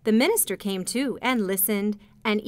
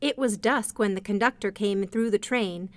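ordinary delivery, nothing marked

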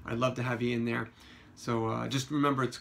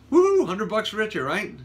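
loud, much higher whoop; celebrating cheer; 7 semitones higher, 8 dB louder than usual. Real, not acted. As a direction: gloat over the easy win. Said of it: "celebrating the won bet".

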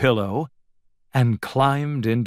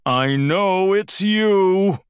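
slow, higher, drawn-out claim; uncertain bravado; 8 semitones higher, 4 dB louder, slower than usual. A narrator's reading. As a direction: insist while unsure. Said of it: "didn't sound very certain".

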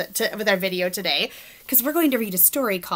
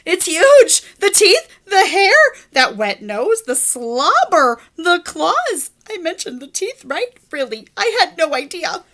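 higher, louder panicky pigeon; scared pigeon; 8 semitones higher, 7 dB louder than usual. Acted. Pigeon shocked by the puppy, panicking. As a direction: list it in growing alarm.

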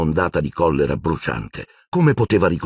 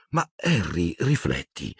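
softer, pleading to reason; gentle insistence; 5 dB quieter, slower than usual. A narrator's reading. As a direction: coax him quietly to think. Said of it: "insiste Hermione". (insistendo sottovoce)

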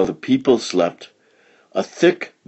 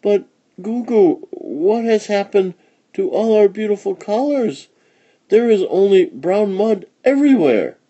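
put-on higher child voice, a mild wail; child mildly wailing to grandmother; child's wail; 12 semitones higher than usual. A narrator's reading. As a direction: lament the lost colors like a child. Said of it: "wailed Kukamba".

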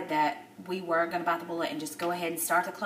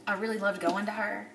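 ordinary talk, nothing marked

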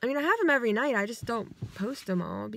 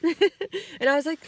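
laughing; 7 semitones higher, 4 dB louder, slower than usual. Real, not acted. Laughing while retelling dream.

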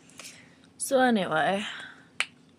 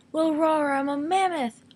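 higher, playful mock roar; mock mammoth roar; 7 semitones higher than usual. Playing pretend animals, a higher playful mammoth roar. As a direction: growl out a silly mammoth.

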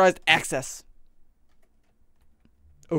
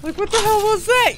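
much higher, shocked question; startled; 4 dB louder than usual. Real, not acted. Gamer shocked by what just jumped out, high-pitched. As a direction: squeal it in disbelief.

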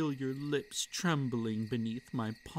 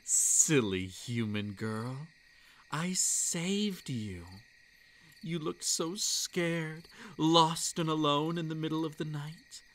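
fond teasing creature; 5 semitones higher than usual. Acted. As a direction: coo at the silly human girl. Creature tells girl it saved her.

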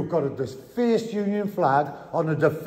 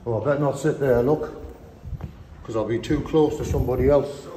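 ordinary talk

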